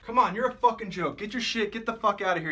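high-pitched, heated tirade; angry dismissal. Acted. Boss ordering the DJ out, angry tirade.

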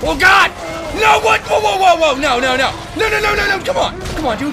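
very high, loud frantic yells; panicked screaming. Real, not acted. Screaming while attacked.

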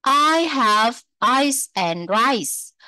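slow, clear "ice" and "rice"; đọc chậm mẫu câu; slow model reading. Shown as a demonstration. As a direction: read "ice" and "rice" slowly for the class to repeat. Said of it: "model sentence for repeating".

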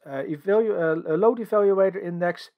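ordinary delivery

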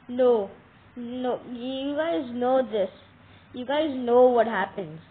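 loud, slow, drawn-out protest; loud dragged-out groan of dismay; loud despairing wail. Real, not acted. Monster attacks at night.

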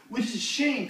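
ordinary talk, nothing marked

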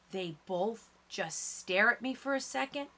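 plain reading voice

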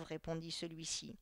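ordinary delivery, nothing marked

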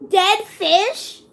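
disgusted surprise. Real, not acted. Reads a gross flavor.

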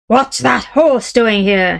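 father roars at his son; roared. Acted. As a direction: bellow it like a giant of a man. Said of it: "he roared".

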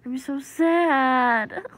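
slow, loud drawn-out wail; mock wail. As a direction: wail it out dramatically.

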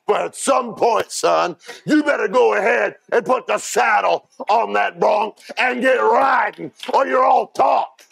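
a preacher daring his flock to ride, shouting; preaching at full force. Acted. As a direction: build to a roaring challenge to the congregation.

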